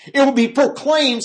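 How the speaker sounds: high, loud proclamation; proclaiming. Shown as a demonstration. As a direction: announce it out for all to hear. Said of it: "See the contrast between".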